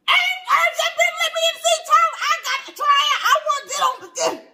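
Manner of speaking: high, loud, fast laughing auntie; hammed-up eager aunt; excited Aunt Clara. Aunt Clara begging to try the fish, high and excited. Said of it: "and Aunt Clara".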